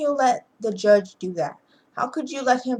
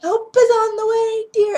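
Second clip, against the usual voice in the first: put-on much higher, louder old nanny voice; Mrs Doubtfire voice; 8 dB louder than usual. Daniel rushing to the choking man, in a high, loud put-on nanny voice. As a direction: sing it out like a fussing old nanny. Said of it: "instead of talking in the Daniel voice".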